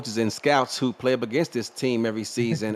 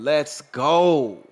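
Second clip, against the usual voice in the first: mild mock sideline yell, louder and higher; imitated yell; 4 semitones higher, 5 dB louder than usual. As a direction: mimic a cheering quarterback, raised voice. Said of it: "who was on the sidelines yelling".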